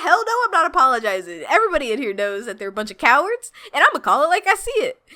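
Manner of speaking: fast, high-pitched mock defiant retort; imitated defiance. Alita refusing to apologize to the bar, imitated defiance. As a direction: rattle off an acted refusal to back down.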